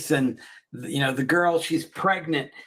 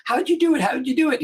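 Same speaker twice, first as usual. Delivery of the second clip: much higher, eager imitation; mock urgent questioning; 9 semitones higher than usual. Retelling doctor begging to learn the cure, mock urgent. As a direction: press for the secret in a high, excited voice.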